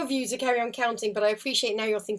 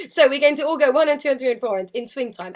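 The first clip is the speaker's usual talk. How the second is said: over-articulated, louder counting; every beat spelled out loud and clear; exaggerated clear counting; 5 dB louder, faster than usual. Shown as a demonstration. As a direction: lead the count like a class leader. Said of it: "Look how obvious I'm making it".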